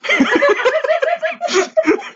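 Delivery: squealing high laugh; laughing at own silly accent. Real, not acted. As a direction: break into a high giggle.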